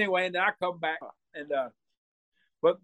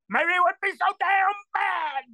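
high yelled retort; 13 semitones higher, 4 dB louder, slower than usual. Retold or imitated. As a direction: cry it out high and slow. Snapping back at Fritz.

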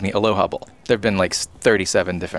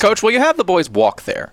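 much higher, cheeky question; high reporter voice; 10 semitones higher than usual. Pretend. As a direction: toss it up high, teasing. Mock press question to coach.

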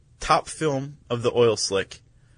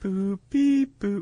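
high beep boop; 8 semitones higher, slower than usual. Shown as a demonstration. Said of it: "so start going beep".

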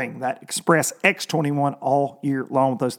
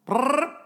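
engine imitation; 12 semitones higher than usual.

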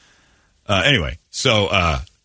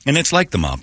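usual speaking voice

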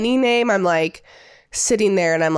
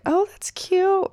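high, slow, strained "oh that's cute"; awkward polite reply; 12 semitones higher, much slower than usual. Retold or imitated. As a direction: play the stiff, forced politeness, much higher. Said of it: "they don't know if they're supposed to laugh or be like".

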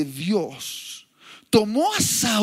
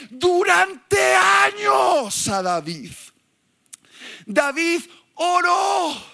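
fiery preaching; 7 semitones higher than usual. Acted. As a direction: preach it at full force. Preaching climax with shouted quotes.

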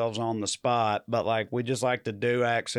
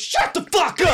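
high, loud mock angry shout; imitating an aggressive shout; aggressive yell; 5 dB louder than usual. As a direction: yell it at them from a passing car. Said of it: "very aggressive".

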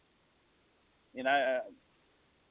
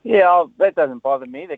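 fired-up burst; 12 dB louder than usual. Real, not acted. Caller answers host, fired up.

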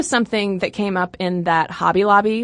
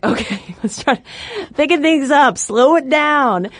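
voicing the hormone; 6 semitones higher than usual. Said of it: "they're like".

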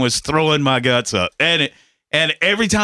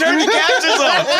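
panicked plea; 11 semitones higher than usual. Retold or imitated. Husband pleads with wife to turn captions off, high and laughing. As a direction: beg for it to stop while cracking up.

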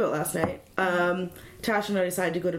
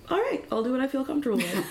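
high, amused, a bit quick; sly laughing reply; 4 semitones higher, faster than usual. Retold or imitated. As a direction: say it with a knowing laugh. Retold own sly reply.

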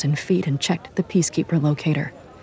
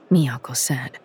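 usual speaking voice

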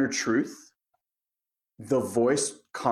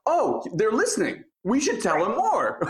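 much higher, gushing excitement; thrilled guides; 9 semitones higher than usual. Retold or imitated. Spirit guides delighted that a person listens, high gushing voice. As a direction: squeal with delight that someone hears you.

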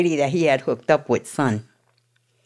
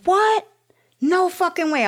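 high, stunned What? No way; retold outrage, pitched way up; shocked disbelief. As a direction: voice jumping up in disbelief. Retelling her shocked reply.

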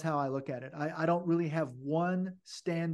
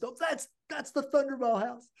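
excited; 8 semitones higher than usual. Retold or imitated. Excited recognition from cab.

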